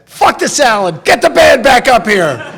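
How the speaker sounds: loud, high mock yell; hammed-up furious dad demand; dad's outburst. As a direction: roar it like a father who wants the dancing back. Dad ordering band back.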